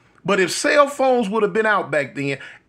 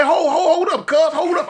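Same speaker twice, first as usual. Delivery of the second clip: urgent hold up; 8 semitones higher than usual. Retold or imitated. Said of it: "I say".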